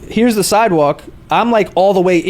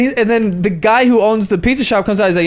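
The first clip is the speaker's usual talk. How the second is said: ordinary talk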